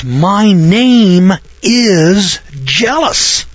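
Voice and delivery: booming, drawn-out divine proclamation; very slow and high, each word stretched out; God's voice. Acted. Quotes God declaring His name.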